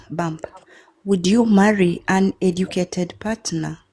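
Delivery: usual speaking voice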